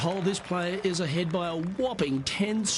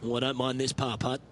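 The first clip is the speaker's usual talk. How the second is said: golf commentator; 4 semitones lower, 7 dB quieter than usual. Acted.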